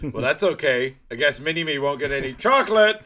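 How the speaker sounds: loud, taunting mock scolding; teasing villain. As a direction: tease the sleepy clone out loud. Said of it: "he goes".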